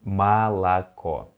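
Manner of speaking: молоко said slowly as ma-la-KO, stress on the last O; slow Russian model word. Shown as a demonstration. Teacher slowly models молоко for students to repeat. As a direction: stretch молоко out, unstressed O's as A. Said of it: "Молоко actually means milk".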